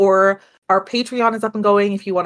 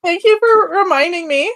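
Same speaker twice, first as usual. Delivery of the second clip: sarcastic thanks; 9 semitones higher, 4 dB louder than usual. Retold or imitated. Mock thanks in her voice.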